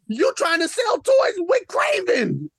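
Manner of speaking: first angry shout; indignant shout. Real, not acted. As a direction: shout it with stress on toys. First take of repeated shout.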